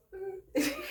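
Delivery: high, soft flirty hum. Pretend. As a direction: hum it like a wink to a guy. Flirty wink sound again.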